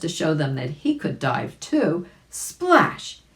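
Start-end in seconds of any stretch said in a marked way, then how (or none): 2.3-3.2 high, punchy splash word; sound-effect splash. A narrator's reading. Reading a children's story, splash said as a playful high sound. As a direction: pop the word like water hitting.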